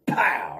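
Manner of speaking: explosive "pow"; imitated loud impact. Betting on the strap's speed, imitated loud impact.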